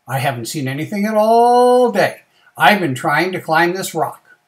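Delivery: a lightly voiced, emphatic turtle; turtle's complaint.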